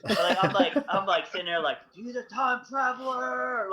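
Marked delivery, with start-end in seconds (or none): 1.9-3.7 high, drawn-out mock exclamation; mind-blown excitement. Telling Jen about the mustache guy, hammed-up mind-blown excitement. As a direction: stretch it out high, reenacting giddy amazement. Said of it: "my mind was blown".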